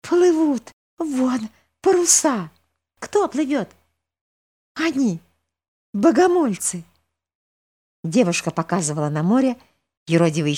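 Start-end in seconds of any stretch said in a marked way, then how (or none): 0.0-6.8 put-on excited girl. Girl spotting sails.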